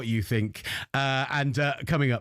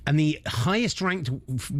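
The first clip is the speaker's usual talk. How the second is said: ordinary delivery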